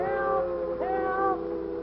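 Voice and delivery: high, distant, softened wail; cry for help. Acted. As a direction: call for rescue from far off.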